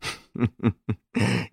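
short amused chuckle; rueful laugh. A narrator's reading. Lupin laughing at himself with his friend, short chuckle. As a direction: laugh at his own rage. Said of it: "he laughed himself".

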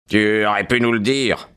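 usual speaking voice

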